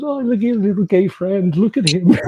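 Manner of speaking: high, loud, strange jeering voice; mocking German guy. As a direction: taunt him like a drunk brute. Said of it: "goes to Justin, like, by the shoulders, goes".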